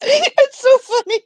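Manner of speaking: squeaky high words through helpless laughter; laughing. Real, not acted. Streamer begging chat to keep the name, high helpless laughter. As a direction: laugh so hard the voice climbs and breaks. Cracking up over account name.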